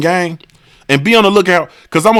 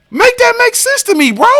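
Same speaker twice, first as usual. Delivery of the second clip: much higher, exasperated plea; incredulous. Real, not acted. Begging the commenter to make it make sense. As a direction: squeal it in disbelief.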